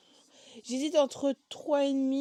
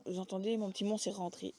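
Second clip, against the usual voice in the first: usual speaking voice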